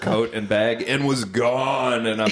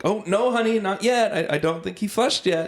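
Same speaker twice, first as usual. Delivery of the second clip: higher, sweet husband voice stopping her; fake husband correcting; 10 semitones higher than usual. Actor playing husband to his fake wife, higher and sweet. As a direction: gently reel the TV wife back in. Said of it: "So I'm like".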